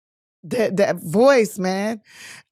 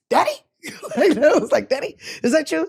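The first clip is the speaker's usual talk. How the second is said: put-on childlike wonder; 7 semitones higher than usual. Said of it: "It was like".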